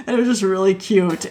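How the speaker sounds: ordinary delivery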